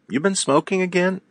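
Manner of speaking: playful disbelieving jab; mock surprised accusation. Catching a friend who smells of smoke.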